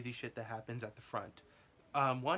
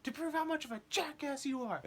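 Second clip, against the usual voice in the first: much higher put-on threatening whine; ranting homeless guy; 14 semitones higher than usual. Yelling at the coworker in line, mock high whiny threat. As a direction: threaten him in a squeaky silly voice. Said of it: "saying, like".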